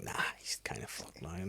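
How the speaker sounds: low, voiceless whisper. Retold or imitated. To herself over the dying goldfish, a low voiceless whisper. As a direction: whisper the bad news about the fish. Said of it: "I'm like".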